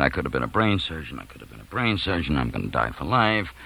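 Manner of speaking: low, soft, dazed mumbling; hushed, repetitive self-pity; muttered lament. Retold or imitated. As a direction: mumble it to yourself, doomed. Panicking friend's muttered lament, retold.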